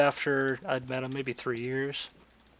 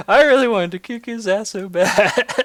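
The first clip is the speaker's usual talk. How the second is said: laughing; 6 semitones higher, 7 dB louder than usual. Real, not acted. Laughing at his own story.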